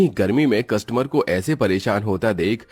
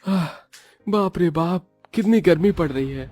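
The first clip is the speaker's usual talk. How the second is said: higher, sweltering dhaba owner groaning about the heat; a higher cartoon shopkeeper moaning at the heat; 9 semitones higher than usual.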